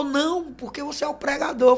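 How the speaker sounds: lightly imitated high-pitched refusal; protesting church sister. Church sister declining the preacher's money, protesting.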